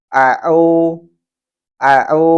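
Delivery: Au with a pressed mid-throat ain where hamzah belongs; wrong hamzah as ain. Shown as a demonstration. Teacher showing hamzah wrongly read as ain. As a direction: squeeze Au from the middle of the throat. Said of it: "Atau sebaliknya, hamzah dibaca ain".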